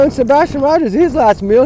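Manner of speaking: ordinary delivery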